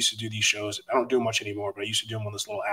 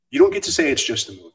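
loud and high, hammering the point; emphatic outburst; 6 dB louder, faster than usual. Real, not acted. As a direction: burst out, fed up. Ranting against critics.

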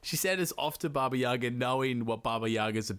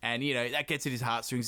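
ordinary talk

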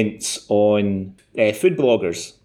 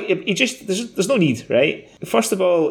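usual speaking voice

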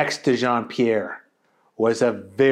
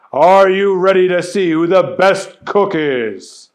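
game show host; 5 semitones higher, 8 dB louder than usual. A narrator's reading. Chet greets the contestants, loud host voice. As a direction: boom it like a TV host.

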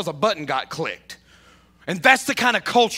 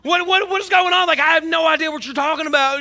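loud, much higher, stammering apology; flustered shouting; 12 semitones higher, 7 dB louder than usual. Retold or imitated. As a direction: blurt out sorry in a flustered shout. Baffled, flustered reply to crying friend.

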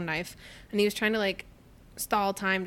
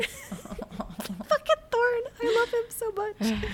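high, squeaky words through laughing; fond helpless laughter; 13 semitones higher, much slower than usual. Real, not acted. Reader adoring Thorn's joke, laughing fondly. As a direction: gush while out of breath from laughing.

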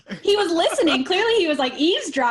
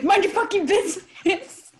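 mock telling-off, higher and stretched out slowly; imitating passive-aggressive snap; 7 semitones higher, slower than usual. Teasing a friend by replaying their outburst, mock aggressive. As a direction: mimic a friend's aggressive comeback, pitched up. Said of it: "You sounded so passive aggressive".

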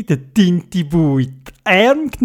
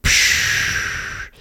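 long voiceless diving-gear hiss; imitated breathing hiss; 4 dB quieter than usual. A narrator's reading. Theobald about to speak underwater, imitated airy hiss. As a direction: let out a long rushing "psch" of air.

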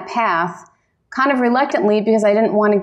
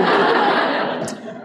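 breathy laugh. Real, not acted. Laughs after throwing up joke.

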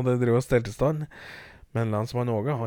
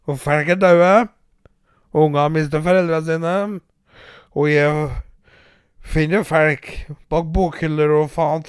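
higher, louder furious tirade; angry threat; 4 semitones higher, 5 dB louder than usual. Acted. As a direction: confront him, rising into rage. Sheriff confronts the killer, pistol drawn.

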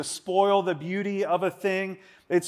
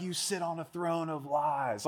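hushed but forceful, much softer; whisper yell; 10 dB quieter than usual. Retold or imitated. Buddy accusing the fake Santa, in a whisper yell. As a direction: hiss it like a furious secret. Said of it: "whisper yells at him".

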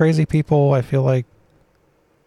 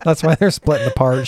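usual speaking voice

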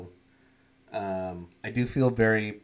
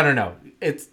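ordinary delivery

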